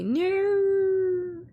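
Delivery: long high-pitched "no"; drawn-out, playful squeal of protest; mock whiny no. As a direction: swoop up high and hold it. Mock protest about not getting free games.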